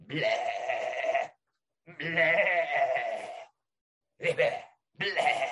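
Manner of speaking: short bleating bleh noises; croissant bleat. Retold or imitated. Croissants making little noises, mock bleating. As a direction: bleat like a French sheep. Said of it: "their little croissanty, croissant noises".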